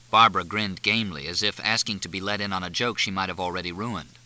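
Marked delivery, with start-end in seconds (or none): none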